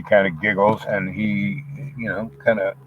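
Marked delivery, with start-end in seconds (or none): none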